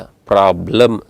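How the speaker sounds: ಪ್ರಾಬ್ಲಮ್ in two beats, pra-blam; correct pronunciation. Shown as a demonstration. Teacher correcting the class, ಪ್ರಾಬ್ಲಮ್ as two-syllable pra-blam. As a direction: run b straight into l in ಪ್ರಾಬ್ಲಮ್. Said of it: "ಅಲ್ಲ".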